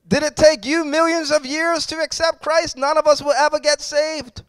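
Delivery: loud, driving sermon voice, hitting each phrase hard; preaching at full force. Acted. Pastor exhorting the congregation on God's power, loud full-force preaching. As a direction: preach it out with rising fire.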